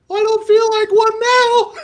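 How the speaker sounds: very high squeaky cartoon voice; comically high, pushed falsetto whine; Mickey Mouse. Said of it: "Like this Mickey Mouse voice".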